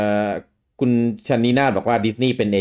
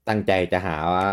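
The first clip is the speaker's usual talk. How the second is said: ordinary delivery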